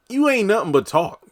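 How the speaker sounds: high-pitched scoffing retort; mock taunt. Recapper answering Victoria's threat, high mock taunt. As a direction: throw it out high and punchy, calling a bluff.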